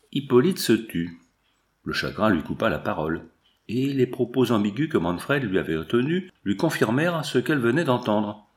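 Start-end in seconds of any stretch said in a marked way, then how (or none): none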